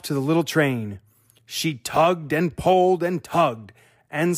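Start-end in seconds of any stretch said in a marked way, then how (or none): none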